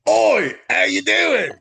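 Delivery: high, slow exaggerated British accent. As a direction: ham up a cheeky British greeting. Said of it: "but they're talking in".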